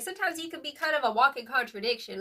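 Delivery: normal talking voice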